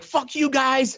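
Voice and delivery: squeaky high angry snake; cartoon snake cursing at the family. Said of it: "I'm like".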